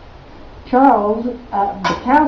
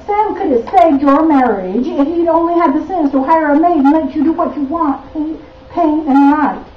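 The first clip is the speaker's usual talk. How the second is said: scolding friend; 5 semitones higher than usual. Acted. Scolding friend's voice resumes in poem.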